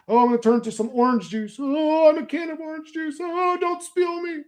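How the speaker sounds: high, squeaky mock panic of a tripper; mocking a tripper who thinks he is orange juice, high and whiny; orange juice guy. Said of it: "we've always wanna say".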